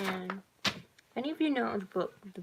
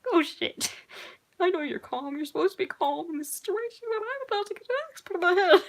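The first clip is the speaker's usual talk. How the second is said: laughing panic; 10 semitones higher, 3 dB louder than usual. Real, not acted.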